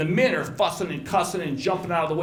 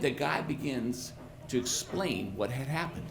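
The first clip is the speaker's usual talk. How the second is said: ordinary delivery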